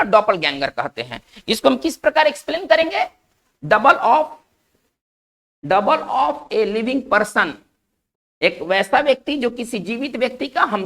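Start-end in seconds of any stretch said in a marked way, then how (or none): 3.6-7.5 very slow, word by word for copying down; slow dictation. Shown as a demonstration. Teacher dictating the English meaning to students, very slow. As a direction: dictate it slowly, one word at a time. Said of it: "teacher dictating meaning".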